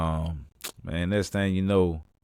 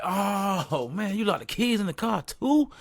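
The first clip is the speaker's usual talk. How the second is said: much higher, rising disbelief; exasperated surprise; 12 semitones higher than usual. Retold or imitated. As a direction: friend locked the keys in the car too. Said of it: "We like".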